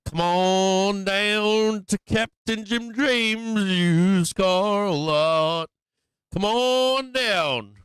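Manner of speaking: high, loud, slow huckster drawl; used car ad. Pretend. Streamer hams a used car dealer's pitch, high and slow. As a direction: stretch every word like a cheesy TV car ad.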